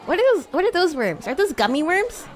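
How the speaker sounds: normal talking voice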